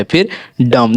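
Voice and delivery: ordinary delivery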